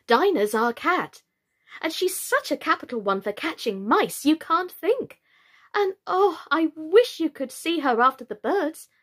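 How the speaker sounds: put-on high, lively girlish boasting; eager. A narrator's reading. Alice talking about her pet, eagerly. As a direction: gush with big swoops of pitch. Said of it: "Alice replied eagerly".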